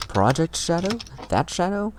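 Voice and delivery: incredulous, high-pitched. Acted. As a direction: pitch shooting up in disbelief, rising on Shadow. Acting incredulous character lines.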